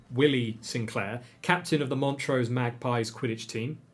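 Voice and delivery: ordinary delivery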